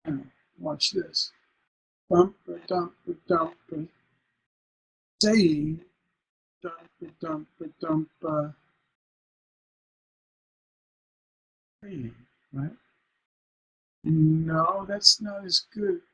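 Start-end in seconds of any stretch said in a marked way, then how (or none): 2.1-5.8 rhythmic beats on "dump", "saying" stressed on its first syllable; pause, then "SAY-ing" with a weak tail; feminine ending. Shown as a demonstration. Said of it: "feminine ending". 6.6-12.1 chanted beats, "saying" falling away on the second syllable; "SAY-ing" after a steady beat; feminine ending. Shown as a demonstration. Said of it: "feminine ending".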